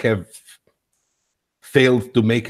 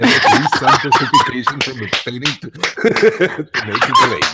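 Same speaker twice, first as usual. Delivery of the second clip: laughing; 2 semitones higher, 5 dB louder than usual. Real, not acted. Laughs at his own tangle.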